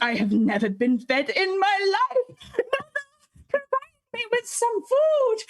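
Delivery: slow, whimpering cat, the last words jumping up high; mock-tragic cat whine, slow and high at the end; starving cat plea. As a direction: play the cat begging as if never fed, dragging it out. Cat voiced begging for food.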